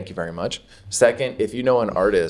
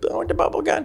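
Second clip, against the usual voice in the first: put-on much higher bratty child voice; whiny kid; faster than usual. Kid at the mall demanding the bubble gun. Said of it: "I'm like".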